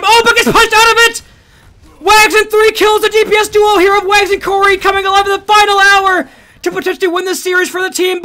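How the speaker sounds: excited commentary. Real, not acted. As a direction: erupt as the team turns it. Caster reacts to clutch kills.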